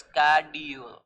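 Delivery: schedule starting with "sk"; schedule with a hard k after the s; American k sound. Shown as a demonstration. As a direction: say schedule slowly as SKAD-yool. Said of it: "सीएच sound करता है किसका?".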